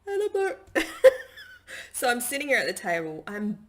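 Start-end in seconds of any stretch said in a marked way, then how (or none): 0.9-1.9 loud, high burst of laughter; real laugh. Real, not acted. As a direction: laugh out freely, caught up in the memory. Laughs while retelling emotional vision.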